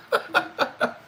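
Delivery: breathy laugh; whispery laugh. Real, not acted. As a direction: laugh under her breath. Laughing after her outburst.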